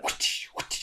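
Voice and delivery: unvoiced whooshing whip sound; whip crack noise. Medium imitating the spirit's whip cracks, whooshed unvoiced. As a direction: breathe out the swish of a whip.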